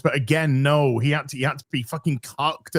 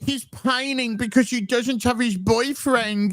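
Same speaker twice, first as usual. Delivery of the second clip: put-on high, drawn-out pouty baby whine; mock him as a lovesick, pining crybaby; slow, whiny mock sulking in a raised pitch; 7 semitones higher than usual. Mocks a character pining over boyfriend.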